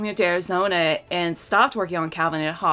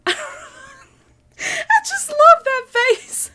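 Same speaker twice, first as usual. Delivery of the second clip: laughing; 6 dB louder, much slower than usual. Real, not acted. Laughing at favorite strip.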